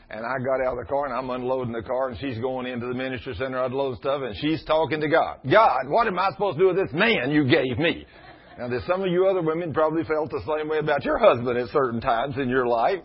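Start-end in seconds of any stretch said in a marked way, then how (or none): 5.5-8.0 higher, louder fussing at God; mock exasperated wife; exasperated complaint. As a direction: imitate the fed-up wife venting upward. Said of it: "she's fussing and at me and at God".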